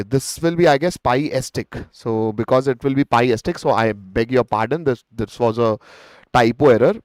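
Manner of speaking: normal talking voice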